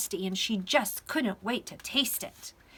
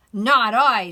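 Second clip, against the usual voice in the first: very loud, emphatic refusal; storybook pig; 2 semitones higher, 15 dB louder than usual. A narrator's reading. Pig refusing to help the hen, very loud. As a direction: blurt it out as a pig. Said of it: "said the pig".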